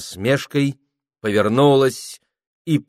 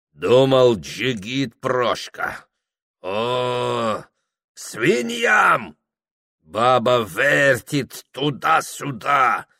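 scornful Circassian; 4 semitones higher, slower than usual. A narrator's reading. Circassian Ibrahim scolds Prokhor.

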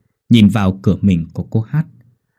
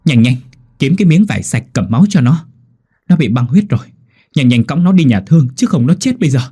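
loud, high frantic orders; shouting urgently; 4 semitones higher, 5 dB louder than usual. A narrator's reading. Midwife shouting orders to the panicked husband, loud and high. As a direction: bark rushed orders to save her. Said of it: "cô hét lên".

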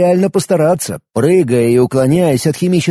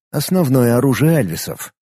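ordinary delivery

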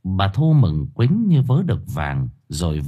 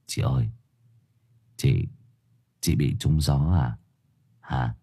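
low, soft worried voice; a lightly voiced worried younger brother; timid young man; 9 semitones lower, 6 dB quieter than usual. A narrator's reading. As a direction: ask quietly and uneasily.

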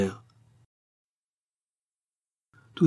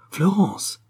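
sudden cry; 9 semitones higher than usual. A narrator's reading. Harry crying out to Florence to stop her, sudden high exclamation. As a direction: exclaim her name, pitched much higher. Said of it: "s'écria-t-il".

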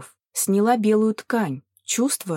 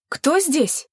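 raised, high-pitched challenge; wary call; 7 semitones higher than usual. A narrator's reading. Lilan answering an unseen caller, calling out warily. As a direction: call it out higher.

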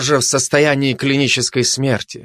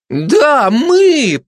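very high, drawn-out yell; backing her up loudly; 13 semitones higher, 4 dB louder than usual. A narrator's reading. Gossip backs the glutton against rivals, yelling. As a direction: chime in at the top of your voice. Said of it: "поддержала её сплетница".

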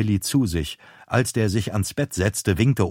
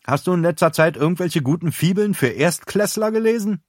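teasing; 9 semitones higher than usual. A narrator's reading. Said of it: "wandte sich grinsend an Willy".

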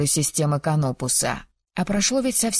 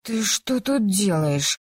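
hoarse, slow, just woken up; hoarse sleepy voice; 3 semitones higher, slower than usual. A narrator's reading. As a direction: croak it out half asleep. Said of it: "хриплым спросонья голосом".

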